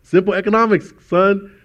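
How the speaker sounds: high, loud know-it-all mother; lecturing mom. Retold or imitated. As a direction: spell it out like a smug mom. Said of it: "my mom was like".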